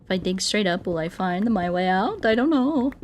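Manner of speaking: ordinary talk, nothing marked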